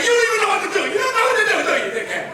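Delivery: put-on loud, high jeer at a clueless kid; loud taunt, dropping on the final jab; gleeful taunting. Father taunts a baffled cashier.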